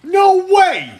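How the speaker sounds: high, loud exclamation; loud surprise. Pretend. Dad reacting to Coraline claim, loud surprise. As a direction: burst it out in disbelief. Dad's outburst.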